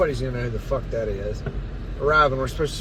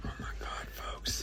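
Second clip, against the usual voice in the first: breathy unvoiced whisper; 13 dB quieter than usual. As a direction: whisper it to the audience. Hushed aside to the audience.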